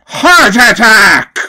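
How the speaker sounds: slow, loud shouted move name; shouted attack. Acted.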